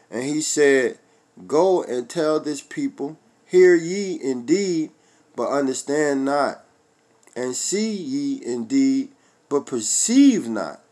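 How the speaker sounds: slow solemn reading of Isaiah, rising on hear; scripture reading. Acted. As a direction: read the verse slowly like scripture. Rereads Isaiah with verse nine.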